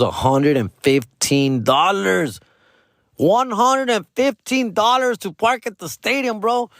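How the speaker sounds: FIFTEEN DOLLARS drawn out, each word leaned on; indignant emphasis. Real, not acted. Outraged at the parking price.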